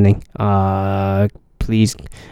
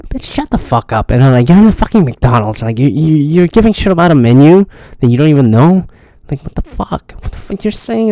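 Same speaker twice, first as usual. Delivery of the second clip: ranting retort; 6 semitones higher than usual. Retold or imitated. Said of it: "and I was like".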